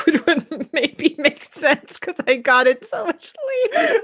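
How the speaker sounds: laughing. Real, not acted. Laughing at herself.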